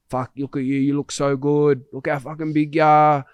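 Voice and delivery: excited. Retold or imitated. Old friend's emotional reunion quote.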